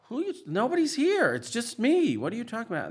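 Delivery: wife's alarmed reply. Retold or imitated. Wife startled by her husband's screams, alarmed confused shout. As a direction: shout back at someone who is panicking.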